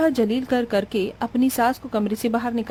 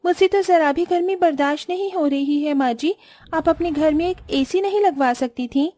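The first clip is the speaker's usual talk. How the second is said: higher, whiny complaining bride; spoiled rich bride; 7 semitones higher than usual. Acted. Rich new bride complains about the heat, higher and whiny. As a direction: fan yourself and complain to the mother-in-law.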